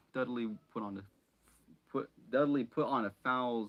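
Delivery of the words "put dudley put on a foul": plain reading voice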